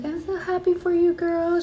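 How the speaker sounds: high-pitched mock happy-cry; squealing imitation of tearful joy; tearfully happy. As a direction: gush like you're crying for a friend's good news. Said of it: "she's crying and I'm crying".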